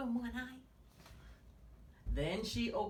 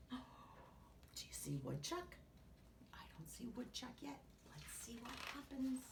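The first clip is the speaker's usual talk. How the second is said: put-on hushed whispered aside to the kids; storyteller whisper; 3 semitones higher, 11 dB quieter than usual. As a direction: whisper as if not to wake anyone.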